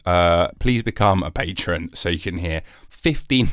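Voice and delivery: ordinary talk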